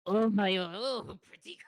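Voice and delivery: high, faltering mock-French accent; attempted French accent. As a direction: try on a fake French accent, breaking off. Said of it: "I'll get with the French accent this time".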